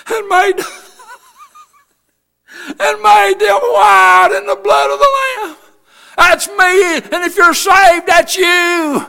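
high, drawn-out cry breaking into a shout; overcome exultant shouting. Real, not acted. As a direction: preach it at the top of your range, overcome. Preaching climax, overcome with salvation.

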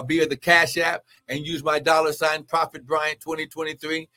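ordinary talk

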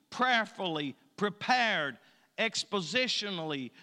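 loud, rhythmic poem reading with punchy lists; poem recitation. Acted. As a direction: declaim each word of the list. Resumes the poem.

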